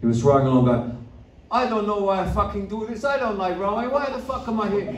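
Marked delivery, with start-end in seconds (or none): 1.5-4.9 high, soft complaining voice; whining Swede. Retold or imitated. Swedish friend grumbling while rowing, high whine. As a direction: moan it like a miserable rower.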